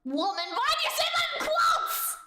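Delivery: high shout rising through laughter; mock outrage. Streamer scolding a chatter over quotes, mock-outraged shout. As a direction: yell it in comic indignation.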